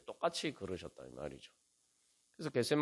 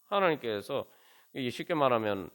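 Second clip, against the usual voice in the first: ordinary delivery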